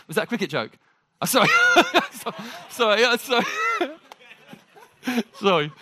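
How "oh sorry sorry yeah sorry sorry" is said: laughing apology. Real, not acted. Preacher to a joker in the audience, laughing apology. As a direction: apologise through real laughter. Gets an audience member's cricket joke late.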